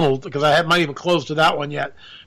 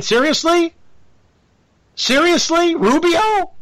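high disbelieving "Rubio?"; incredulous; 9 semitones higher, slower than usual. Real, not acted. Host scoffing at backing Rubio, high incredulous. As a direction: ask it in total disbelief.

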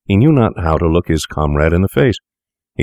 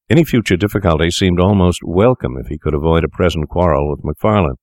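ordinary delivery, nothing marked

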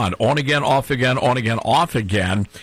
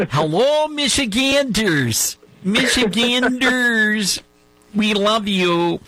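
higher, slow, drawn-out mock of her weird accent; Haley Stevens's weird accent; 8 semitones higher, slower than usual. Host mocking the congresswoman greeting voters, higher slow mock accent. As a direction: stretch each word in her odd accent, much higher. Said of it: "I love her accent".

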